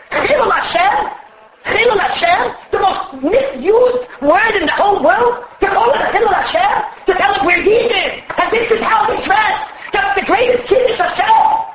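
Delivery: rising sermon fervour, almost shouted; preaching at full force. Acted. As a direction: thunder it out like a fiery preacher. Preacher's own fiery exhortation.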